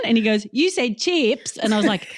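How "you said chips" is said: high, drawn-out Australian "chips"; child imitating Mum's Australian accent; mimicking Australian accent. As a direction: slowly quote back the Australian "chips" like a child. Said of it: "That's my Australian accent".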